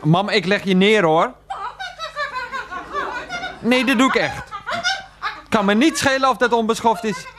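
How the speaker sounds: high, indignant warning; threatening to hang up. Acted. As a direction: warn her with rising exasperation. (geërgerd dreigend)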